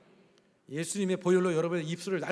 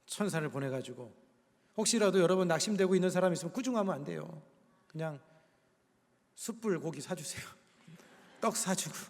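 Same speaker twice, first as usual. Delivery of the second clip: ordinary delivery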